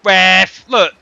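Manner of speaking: sudden loud appeal to the ref; shouting at referee. Real, not acted. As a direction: yell out in protest.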